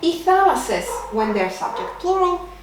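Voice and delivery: ordinary talk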